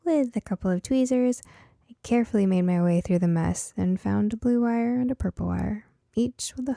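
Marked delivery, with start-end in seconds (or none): none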